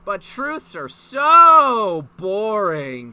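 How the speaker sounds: loud, slow, drawn-out whine; whiny complaint. Acted. Yang complaining to Blake in a loud drawn whine. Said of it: "complained Yang".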